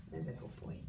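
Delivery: breathy secret praise, no voice; whispered praise. Real, not acted. Quiet praise to dog.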